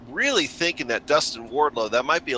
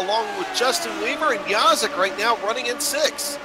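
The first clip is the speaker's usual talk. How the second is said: excited play-by-play; 5 semitones higher than usual. Acted. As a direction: call the battle live. Live race call after green flag.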